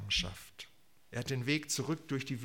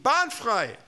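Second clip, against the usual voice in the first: high, loud herald's cry; calling out; 11 semitones higher, 8 dB louder than usual. Acted. Preacher cries out as John the Baptist, loudly. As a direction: shout to clear the way. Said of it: "genau so zu rufen".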